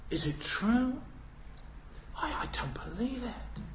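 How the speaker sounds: hushed, whispered disbelief; stunned disbelief. Retold or imitated. Child hearing war news, hushed whisper. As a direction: whisper it in shock. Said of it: "a child might say".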